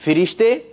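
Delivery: "فرشتے" as fi-rish-te, rising; first pronunciation option. Shown as a demonstration. Quizmaster offering the first choice, fi-rish-te pronunciation. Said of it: "آپ نے درست تلفظ بتانا ہے".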